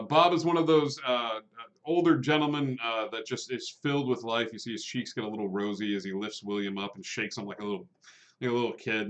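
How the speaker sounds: usual speaking voice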